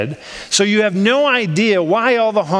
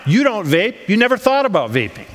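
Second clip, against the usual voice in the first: normal talking voice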